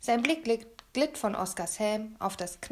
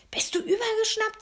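high, squeezed, alarmed hiss; urgent high-pitched scolding; 13 semitones higher, 4 dB louder than usual. A narrator's reading. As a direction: hiss it at a friend in disbelief. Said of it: "zischte ich".

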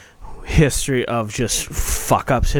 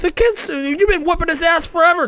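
much higher, exasperated; 14 semitones higher than usual. Retold or imitated. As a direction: whine it out in disbelief. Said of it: "I go".

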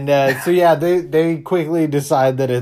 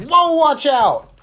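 high, slow mock shout; slow high warning; 12 semitones higher, 4 dB louder, slower than usual. Friend acting out Chuck, high and slow. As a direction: draw out the warning. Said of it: "Chuck's like".

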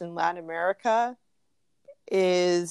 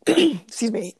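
frog in throat; 6 semitones higher, 6 dB louder than usual. Real, not acted. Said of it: "got, like, a frog in my throat".

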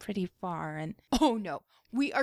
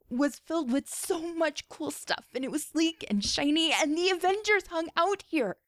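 awed; 8 semitones higher than usual. A narrator's reading. Kamala's awe narrated.

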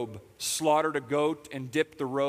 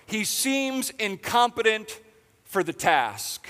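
higher and louder, impassioned; full-force preaching; 6 semitones higher, 6 dB louder than usual. Real, not acted. Pastor preaching to hurting listeners, impassioned. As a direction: drive it home to the room.

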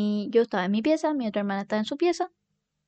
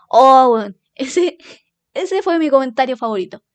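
delighted outburst; 8 dB louder than usual. Real, not acted. Reacting to favorite comment.

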